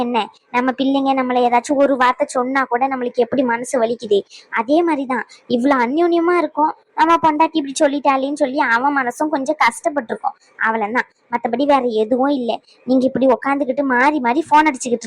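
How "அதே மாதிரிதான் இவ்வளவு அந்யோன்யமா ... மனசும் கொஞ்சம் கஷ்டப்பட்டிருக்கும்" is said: usual speaking voice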